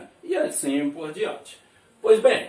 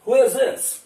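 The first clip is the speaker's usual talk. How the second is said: model question; 6 semitones higher, 6 dB louder than usual. Shown as a demonstration. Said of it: "Veja bem".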